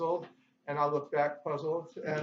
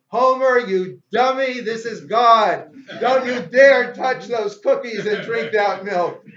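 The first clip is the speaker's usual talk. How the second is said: very loud, high, drawn-out thundering command; God roaring down at Homer; booming voice from heaven; 5 semitones higher, 12 dB louder than usual. Acted. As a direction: thunder each phrase out slowly, then scold. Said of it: "there's a booming voice from heaven".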